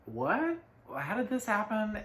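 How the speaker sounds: high, sudden "what?"; startled disbelief. Retold or imitated. Someone who just snapped in an argument, high startled disbelief. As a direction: jump up high, caught off guard. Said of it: "you're just like".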